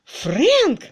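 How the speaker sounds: high, loud, startled cry. A narrator's reading. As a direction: cry out the name. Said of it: "вскрикнула мисс Твиттертон".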